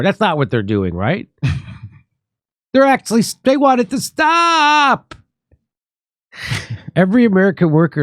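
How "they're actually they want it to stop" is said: very high mock-earnest yell; sarcastic mock outcry. Host mocking Lockheed's supposed wish for peace, in a high sarcastic cry. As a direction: wail it high, drawing out the last word.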